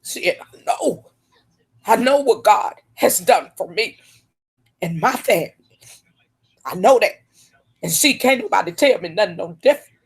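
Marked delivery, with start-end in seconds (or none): 0.0-7.2 tearing up. Real, not acted. Woman on her live stream fighting back tears. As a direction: voice catching as she holds back tears. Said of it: "see, I'm tearing up".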